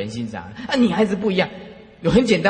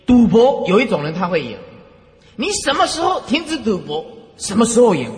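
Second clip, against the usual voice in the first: forceful preaching; 4 semitones higher, 5 dB louder than usual. Real, not acted.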